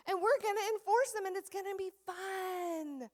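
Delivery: upbeat classroom announcer; cheerful teacher voice. Shown as a demonstration. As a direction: make rules sound fun. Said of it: "That's how you do it".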